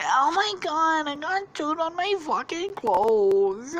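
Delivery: put-on high, slow, drawn-out girly whine; hammed-up high-pitched dismay; Lola from Big Mouth. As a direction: whine it like an appalled diva. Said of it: "she starts sounding like fucking Lola".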